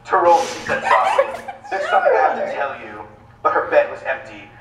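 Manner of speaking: deadpan romance audiobook reading; dramatic reading. Acted. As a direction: finish the story with a straight face. Second reader continues the novel.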